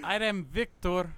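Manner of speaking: slow mock Russian accent; a hammed-up Russian introducing himself. New fake member, mock Russian accent. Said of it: "went out of Russian accent".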